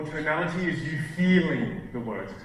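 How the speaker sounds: "feeling" stretched out and swelling with emotion; elongated vowel with emotion. Shown as a demonstration. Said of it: "you elongate the vowel in the word".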